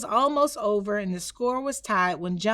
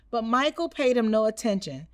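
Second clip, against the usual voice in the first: plain reading voice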